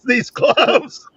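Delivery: laughing. Real, not acted. Laughs mid-sentence at own joke.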